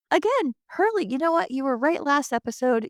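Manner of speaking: high, eager praise; excited. Real, not acted. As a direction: cheer him on brightly. Excited address to Hurley, higher.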